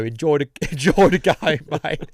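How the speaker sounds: laughing, sing-song mock farewell; imitating girlfriend's send-off. Teasing how Amy sends him off to Palace, mock cheery. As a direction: imitate her cheery goodbye, laughing through it. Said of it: "She'll go".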